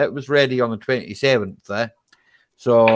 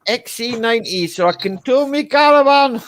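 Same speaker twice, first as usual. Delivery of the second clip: mock voice of friend; 10 semitones higher than usual. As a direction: send up his excuse in a raised mocking voice. Mocking friend buying car.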